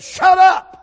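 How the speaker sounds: high, explosive shout; sudden loud outburst; shouted command. Retold or imitated. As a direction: holler it to silence a crier. Preacher snaps at crying companion.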